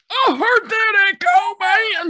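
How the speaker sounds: loud slow call out; echo shout. Acted. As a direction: shout it out slowly. Calling out to hear echo.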